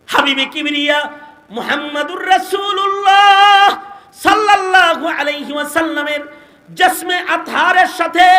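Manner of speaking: high, loud sermon chant with stretched endings; impassioned high-pitched preacher's cry; full-force preaching. Real, not acted. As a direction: belt it out to the congregation, drawing out key words.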